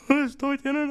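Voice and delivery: starstruck gasp. Retold or imitated. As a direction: squeal it like a starstruck fan. Starstruck at seeing star.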